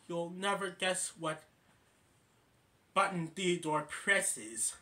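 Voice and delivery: ordinary delivery, nothing marked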